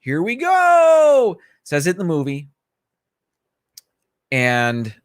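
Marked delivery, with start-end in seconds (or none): 0.0-1.4 put-on slow, rising to a much higher boyish call; slow, building into a high-pitched Peter Pan cry; Peter Pan impression. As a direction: stretch it out and climb way up like Peter Pan taking off. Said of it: "Peter Pan saying".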